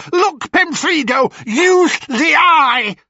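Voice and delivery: high, loud crone shriek; shrieking hag. Acted. Blind hag sniffing out Perseus, high loud shriek. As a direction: screech it like a witch scenting prey. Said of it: "she shrieked".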